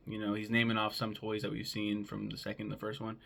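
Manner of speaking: ordinary talk, nothing marked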